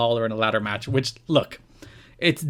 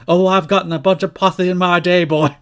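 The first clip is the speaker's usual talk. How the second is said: loud drawling Dusty Rhodes voice, higher pitched; Dusty impression; 5 semitones higher, 6 dB louder than usual. Retelling Dusty's comeback to Lawler, loud hammed-up impression. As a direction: ham up Dusty's lisping southern cut. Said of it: "And then Dusty responds".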